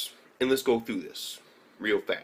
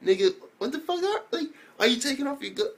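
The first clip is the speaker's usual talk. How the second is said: shocked disbelief; 14 semitones higher, faster than usual. Retold or imitated. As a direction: yelp it out in total disbelief. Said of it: "And I'm like".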